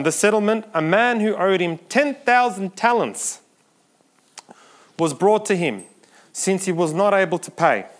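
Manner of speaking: loud, driving preacher's reading of the parable; forceful scripture reading. Acted. Preacher reading the parable to the congregation, loud and driving. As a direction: preach the text out, big and strong.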